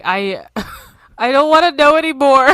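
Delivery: mock horrified. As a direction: laughingly overplay being horrified. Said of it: "I was so horrified. I'm like".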